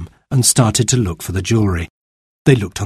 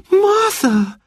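very high startled gasp of the name; a girl shocked by the news. A narrator's reading. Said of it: "gasped Anthea".

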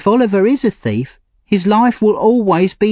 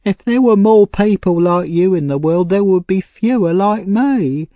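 tearful; 9 semitones higher, 3 dB louder than usual. A narrator's reading. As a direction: plead with a hint of tears. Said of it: "She started to cry".

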